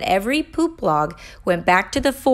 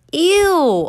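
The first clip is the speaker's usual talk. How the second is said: a loud, high "Ew"; disgusted; 9 semitones higher, 6 dB louder than usual. A narrator's reading. Child reacts to the pooping log, loud and grossed out.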